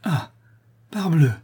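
startled high exclamation; jolted awake, crying out; exclaiming. Acted. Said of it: "s'écria-t-il".

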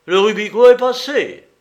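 loud, high proclamation; triumphant exclamation. A narrator's reading. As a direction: cry it out in triumph. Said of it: "s'écria Edward".

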